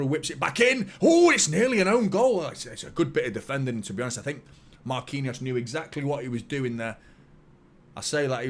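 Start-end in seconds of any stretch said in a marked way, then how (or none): 0.4-2.4 high, loud burst of match commentary; excited commentary shout. Real, not acted. Watchalong host reacting to a near own goal, excited shout. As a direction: shout it as the ball nearly goes in.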